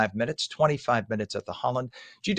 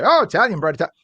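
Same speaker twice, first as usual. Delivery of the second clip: imitating Italians; 6 semitones higher, 8 dB louder, faster than usual. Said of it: "the Italians say".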